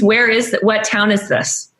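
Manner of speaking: ordinary delivery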